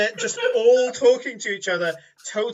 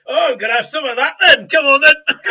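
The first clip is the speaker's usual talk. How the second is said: higher, much louder gleeful challenge; hammed-up tavern crowd itching for a fight; eager brawlers; 7 semitones higher, 13 dB louder than usual. Tavern adventurers answer the threat, loud and gleeful. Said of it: "they're like".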